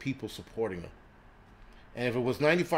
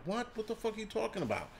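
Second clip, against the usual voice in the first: much higher, mock disbelieving squawk; retold stunned reaction, high and disbelieving; incredulous outburst; 10 semitones higher than usual. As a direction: act baffled by the claim, pitch flying up. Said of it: "I'm like".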